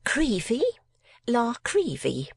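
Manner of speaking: high, affected spinster voice; a mincing lady correcting her name. A narrator's reading. Miss La Creevy answers.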